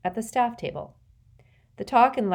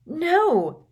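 high, loud cry of protest; shouted, disbelieving refusal; loud outburst; 11 semitones higher, 7 dB louder than usual. A narrator's reading. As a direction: blurt it out so heads turn. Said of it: "so loudly that many heads turned".

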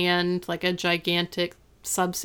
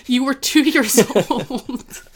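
Eric's retort, slow and laughing through it; louder, slowed, cracking up mid-line; laughing quote; 5 semitones higher, 6 dB louder than usual. Retold or imitated. Eric teasing Topanga about her weight, laughing. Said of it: "And Eric goes".

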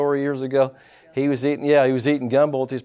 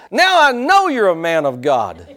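high, loud mock exclamation; hammed-up retold outburst, climbing higher; husband's excited outburst; 12 semitones higher, 4 dB louder than usual. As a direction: burst out as the vindicated husband. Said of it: "he threw his spoon down and said".

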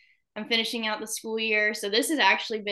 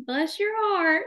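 "Bless your heart" much higher, a foreigner's mock Southern accent; foreigners faking Southern; 10 semitones higher, slower than usual. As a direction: ham up "Bless your heart" like a European trying a Southern drawl. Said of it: "if I ask them to speak Southern, they're like".

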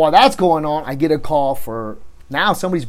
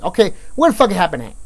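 fast, loud, higher-pitched questioning; retold impatient demand; rude interrogation; 5 semitones higher, 5 dB louder, faster than usual. Officer questioning the detainee he disbelieves, rude. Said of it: "I was rude to her".

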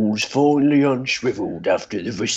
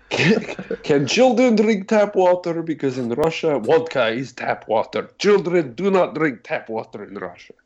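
mock Russian accent; 5 semitones higher than usual. Joking Russian accent bit.

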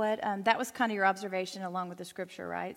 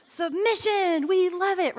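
mock enthusiastic; 9 semitones higher, 5 dB louder, slower than usual. Real, not acted. Mock enthusiasm about a topic.

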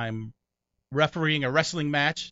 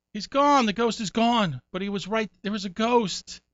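mock spooked; 9 semitones higher, 4 dB louder than usual. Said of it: "roommate insists ghost was there".

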